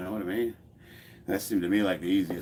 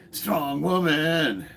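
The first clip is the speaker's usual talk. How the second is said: South Park quote; 7 semitones higher, 5 dB louder, much slower than usual. Retold or imitated. Quoting South Park episode.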